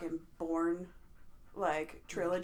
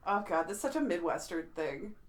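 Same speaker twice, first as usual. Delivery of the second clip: ordinary delivery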